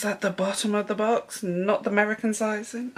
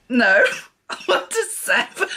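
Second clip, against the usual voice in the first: laughing; 2 semitones higher, 7 dB louder than usual. Retold or imitated. Retelling shop mix-up, laughing.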